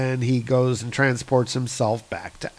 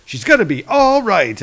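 gleeful, a bit louder; 7 semitones higher, 4 dB louder than usual. Acted. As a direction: let the joy burst through. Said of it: "he looks positively gleeful".

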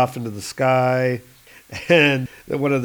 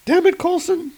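high, bright agent voice; high female voice; 5 dB louder than usual. Acted. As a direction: raise the pitch for the woman agent. Agent scolds Coulson.

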